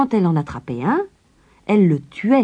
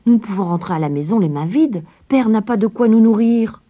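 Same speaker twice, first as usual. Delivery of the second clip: put-on earnest boy, growing louder; Hansel; 5 semitones higher, 5 dB louder than usual. A narrator's reading. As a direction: insist on taking the treasure. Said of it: "dit Hansel".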